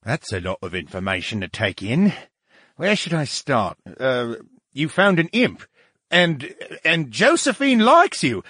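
lightly voiced puzzled brain; flustered talking brain. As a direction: stumble through questions, taken aback.

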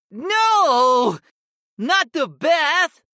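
very high, drawn-out protest; cat's horrified wail. Acted. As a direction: wail it like a cat dreading water. Cat voiced protesting a bath.

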